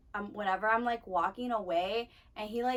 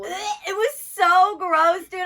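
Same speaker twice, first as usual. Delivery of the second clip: higher, louder, very slow disgust; disgusted outburst; 8 semitones higher, 11 dB louder, much slower than usual. Real, not acted. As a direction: stretch it out, really grossed out. Disgusted recounting.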